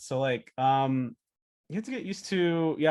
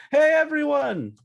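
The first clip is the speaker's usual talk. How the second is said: loud, high, drawn-out hail; showy greeting; 12 semitones higher, 9 dB louder, slower than usual. Acted. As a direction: burst in and announce yourself to the crowd. Acting Guy Diamond greeting the market.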